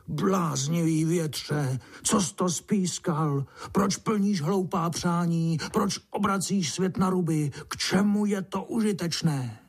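high, indignant scolding; grumpy old ant. A narrator's reading. Grumpy old ant snaps at the wind, crossly scolding. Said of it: "starý mrzutý mravenec se obořil na vítr".

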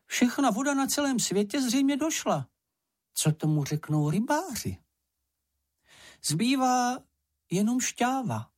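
very high small-animal voice; crestfallen little creature. Acted. Čenich reports that the water is gone, crestfallen high voice. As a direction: report the bad news in a high, piping voice.

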